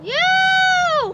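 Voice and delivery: long, high, very loud yell; yelled retort. Real, not acted. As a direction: scream it back at Dad. Child retorts a second time.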